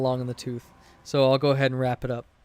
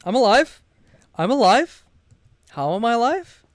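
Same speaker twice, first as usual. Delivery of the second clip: relieved disbelief; 8 semitones higher than usual. Real, not acted. Survives a scare in game.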